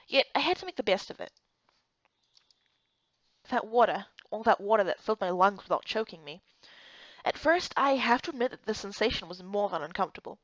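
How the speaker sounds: ordinary talk, nothing marked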